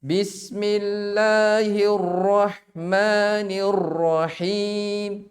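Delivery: Bismillahirrahmanirrahim drawn out slowly with a heavy rolled R; overtrilled basmalah. Shown as a demonstration. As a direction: show the wrong reading, R rattling too long. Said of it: "Apalagi misalnya baca basmalah ya".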